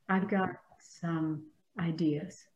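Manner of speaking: soft whispered aside; hushed aside to the group. Real, not acted. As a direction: let it slip out under your breath.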